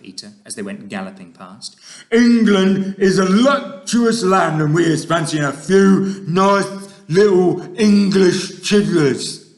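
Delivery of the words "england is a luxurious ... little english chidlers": loud, slow, menacing giant voice; big greedy giant boast; brutish giant. Acted. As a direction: gloat like a hungry monster. Fleshlumpeater gloating about children.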